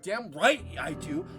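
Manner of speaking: ordinary talk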